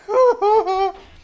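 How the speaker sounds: high bright laugh. Real, not acted. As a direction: burst out laughing. Laughs reading the scene.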